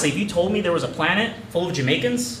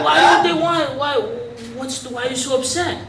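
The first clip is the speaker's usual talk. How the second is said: put-on puzzled kid; 8 semitones higher than usual. Kid pushes back at GM.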